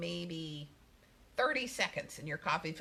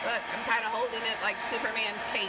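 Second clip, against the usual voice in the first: raised voice over a loud hairdryer; over hair dryer; 4 semitones higher, 4 dB louder than usual. Real, not acted. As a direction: talk up over the dryer noise. Hairdryer running while she talks.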